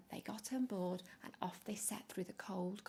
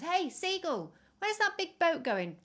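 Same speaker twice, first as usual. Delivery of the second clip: higher, louder shout up to the bird; calling out; 9 semitones higher, 7 dB louder than usual. Acted. Peppy calling out to a seagull, high and loud. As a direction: call across to the seagull.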